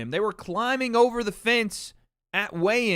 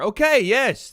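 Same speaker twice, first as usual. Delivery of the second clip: triumphant; 6 semitones higher, 7 dB louder than usual. Real, not acted. Finally remembers the name.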